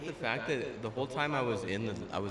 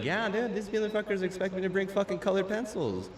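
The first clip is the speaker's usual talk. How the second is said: exasperated retelling; 8 semitones higher than usual. Retold or imitated. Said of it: "I'd be like".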